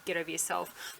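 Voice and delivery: normal talking voice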